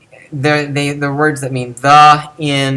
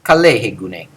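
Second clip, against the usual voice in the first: low, even pitch across the phrase; same low tone; 4 semitones lower than usual. Shown as a demonstration. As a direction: keep "Kalehe gune" on one low pitch. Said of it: "said with the same low tone of voice".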